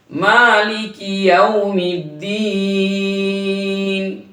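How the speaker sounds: slow melodic tajweed chant; slow sung Quran recitation. Shown as a demonstration. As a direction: draw each word out, recite.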